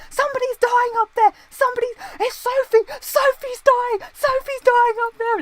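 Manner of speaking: very high, loud, panicked falsetto cry; imitating James Corden's character. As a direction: shriek it up the stairs, mock panic. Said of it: "James Corden's character was like".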